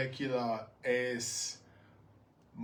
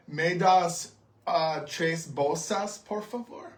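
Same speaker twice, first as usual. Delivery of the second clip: slow, careful Spanish accent with higher, louder start; his Spanish accent; 4 semitones higher, 6 dB louder, slower than usual. Shown as a demonstration. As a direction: order three bags in your best Spanish accent, slowly. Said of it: "give me a phrase, but with your accent. Your Spanish accent".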